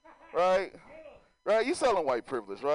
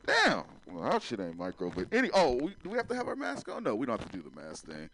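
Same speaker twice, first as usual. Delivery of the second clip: ordinary talk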